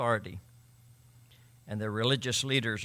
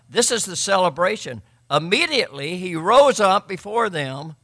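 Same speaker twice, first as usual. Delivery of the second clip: loud, higher, slowed on glorifying God; fervent preaching; 6 semitones higher, 11 dB louder than usual. Acted. Pastor exults over the healed man to his congregation, full-force preaching. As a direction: preach it like a celebration.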